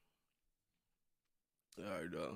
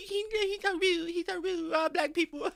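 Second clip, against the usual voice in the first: mock whiny complaint. Teasing mock complaint about viewer.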